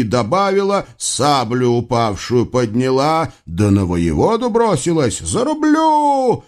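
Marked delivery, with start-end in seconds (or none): 5.2-6.4 very high, drawn-out war cry; girl warrior's high yell; battle cry. A narrator's reading. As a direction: stretch it out as you charge. Battle cry while charging the commander.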